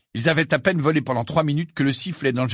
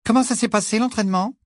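ton froid; 11 semitones higher, faster than usual. A narrator's reading. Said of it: "d'un ton plutôt froid".

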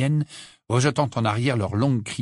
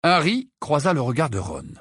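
plain reading voice

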